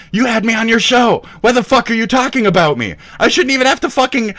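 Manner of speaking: high, loud, rushed outrage; angry shouting. Real, not acted. Streamer confronting rival about his show, angry shouting. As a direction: fire off indignant demands, loud and high.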